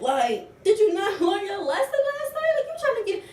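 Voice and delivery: very high, loud mocking taunt with a laugh; taunting shout. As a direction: taunt her to start a rematch. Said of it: "I pressed her ass again saying".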